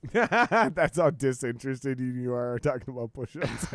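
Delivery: cracking up at the sudden topic change; laughing. Real, not acted. Host laughs as co-host jumps from push-ups to Trump, laughing.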